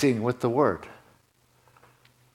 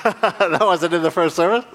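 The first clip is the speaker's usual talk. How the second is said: laughing; 5 semitones higher, 5 dB louder than usual. Real, not acted. Laughing at his own line.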